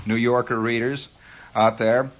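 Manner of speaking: usual speaking voice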